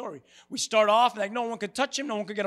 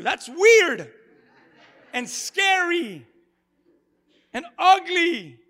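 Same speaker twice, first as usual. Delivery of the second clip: slow, high, loud eerie shriek; a hint of a shrieking demon; 8 semitones higher, 8 dB louder, much slower than usual. Shown as a demonstration. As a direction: shriek it like something possessed. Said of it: "What does that sound like?".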